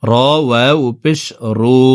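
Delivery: ordinary talk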